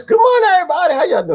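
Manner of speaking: very high-pitched cheery holler; high hello to the crowd; put-on greeting. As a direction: call out the welcome way up high. Repeats put-on greeting to viewers.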